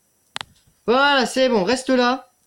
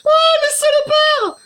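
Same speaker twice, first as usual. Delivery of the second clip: very high, louder and slow shriek; 6 dB louder, slower than usual. Real, not acted. Gamer jumpscared by Foxy, high shrieking curse. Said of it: "J'ai crié comme une fillette".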